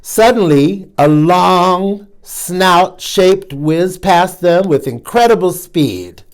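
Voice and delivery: ordinary delivery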